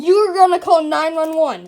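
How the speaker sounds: loud, insistent order; loud demand. Acted. Customer orders the cashier to call 911, loud.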